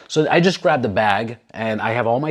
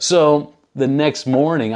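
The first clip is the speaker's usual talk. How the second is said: normal talking voice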